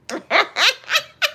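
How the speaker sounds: high tapering laugh. Real, not acted. Joking she'll expose Superwoman, high laugh. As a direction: laugh high, thinning into spaced breaths.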